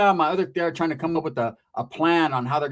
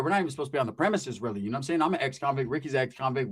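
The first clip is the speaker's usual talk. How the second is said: normal talking voice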